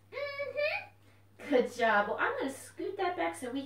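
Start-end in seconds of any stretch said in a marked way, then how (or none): 0.1-1.5 a bouncy little puppet popping up; puppet fox voice. Acted. Puppet Corky agrees.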